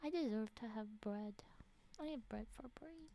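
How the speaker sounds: hushed whisper over her snack; soft unvoiced murmur. Real, not acted. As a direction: whisper it privately to yourself. Whispering while eating bread.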